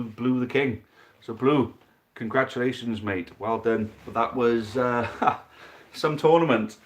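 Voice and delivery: brisk sports commentator calling the match; live play-by-play. Acted. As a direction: call every roll like a match commentator. Live dice football match commentary.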